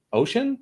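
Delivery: Ocean? rising steeply high at the end, unsure; timid questioning lilt. Acted. Workshop participant answering the coach's prompt, rising unsure question. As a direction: float "Ocean?" up like a nervous question. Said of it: "I'm also gonna lilt up and say it like a question".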